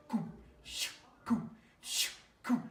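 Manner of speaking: whispered whooshing imitation of falling rounds; breathy shoo coo like shells dropping; mortar whistle sounds.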